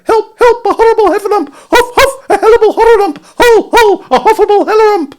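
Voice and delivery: very high, loud squealing panic; Piglet crying out. A narrator's reading. Piglet scampering off as hard as he can, high panicked cries. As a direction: squeal in a babbling, breathless panic. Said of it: "still crying out".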